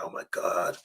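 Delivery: hushed, low, breath-only awe; retold starstruck whisper; awed whisper. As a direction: whisper it in disbelief. Retelling awe at spotting his hero.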